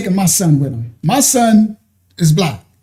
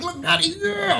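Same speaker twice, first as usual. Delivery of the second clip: put-on high, soft excited kid; squeaky kid showing off; excited little son; 11 semitones higher, 9 dB quieter than usual. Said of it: "He like".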